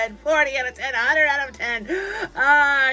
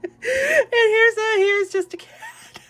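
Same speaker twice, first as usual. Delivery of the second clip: high, laughing delight; giddy excitement; 9 semitones higher, 4 dB louder than usual. Real, not acted. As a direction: gush over the cat. Overjoyed at cat figures.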